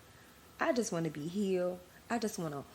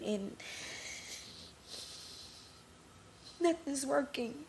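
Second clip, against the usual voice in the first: crying; 3 semitones higher than usual. Real, not acted. Host telling listeners about her doctors, crying. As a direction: push through the words while crying. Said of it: "I said I wasn't gonna cry".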